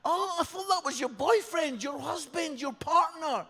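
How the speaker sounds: high-pitched, incredulous outburst; astonished midwife. Retold or imitated. Midwife realising the man is no partner, high astonished voice. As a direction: blurt it high in disbelief.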